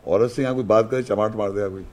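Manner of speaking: usual speaking voice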